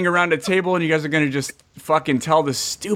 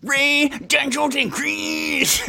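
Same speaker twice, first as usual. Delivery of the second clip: high, strained growl on the names; badly faked growly announcer; growled announcer intro; 8 semitones higher, much slower than usual. Shown as a demonstration. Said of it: "the little growl to it".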